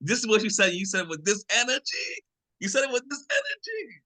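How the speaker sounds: high, incredulous, extra on it; dramatic confrontation. Retold or imitated. To his partner, hammed-up outrage over her tone. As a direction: play up the accusation, pitched high. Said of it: "I'm being a little dramatic. I'm a little extra on it".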